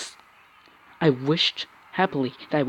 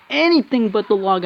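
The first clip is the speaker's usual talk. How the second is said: louder, higher frustrated cry; exasperated outburst; 6 semitones higher, 5 dB louder than usual. Real, not acted. Player shouting at his unlucky card draw. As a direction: throw the complaint out loud. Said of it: "I said".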